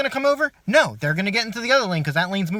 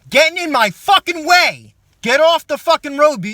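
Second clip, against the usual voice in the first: angry yell; 6 semitones higher, 6 dB louder than usual. Acted. Ranting about bad drivers.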